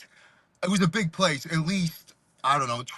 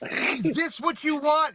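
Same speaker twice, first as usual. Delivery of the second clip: Jimmy challenging; 9 semitones higher, slower than usual. Retold or imitated. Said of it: "He goes".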